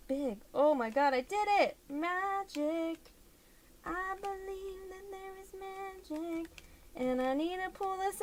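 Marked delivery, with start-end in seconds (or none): 0.5-3.0 triumphant. Real, not acted. Triumph after finishing hard step. 3.9-6.5 dreamy wonder. Pretend. Dreamy remark after success.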